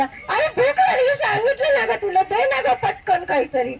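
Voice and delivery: high, whiny mock child's shout; hungry kid's plaintive call, acted; Raju yelling. A narrator's reading. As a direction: pester mum impatiently for food. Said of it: "परत राजू ओरडून म्हणाला".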